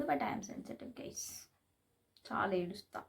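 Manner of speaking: whispered confession to viewers. Real, not acted. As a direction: breathe it out, no voice. Admits being sensitive, drops voice. (గుసగుసగా ఒప్పుకోవడం)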